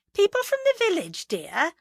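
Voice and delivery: high-pitched, placating wifely chirp; nervous wife. A narrator's reading. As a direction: explain it away sweetly, pitched right up. Nervous wife covers to husband.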